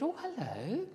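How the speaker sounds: high, surprised "Oh, hello"; imitating a woman. Retelling the lady on the plane, high imitation. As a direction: lift into a lady's high voice. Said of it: "very good imitation of that woman".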